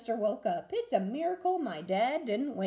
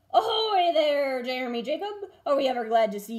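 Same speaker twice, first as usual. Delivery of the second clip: hearty pirate captain; 4 semitones higher, 6 dB louder than usual. A narrator's reading. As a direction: hail him like an old sea captain. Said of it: "said Captain Braidbeard".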